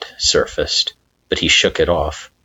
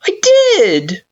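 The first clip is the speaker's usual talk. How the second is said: stammered; 5 dB louder than usual. Acted. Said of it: "Frisk stammered".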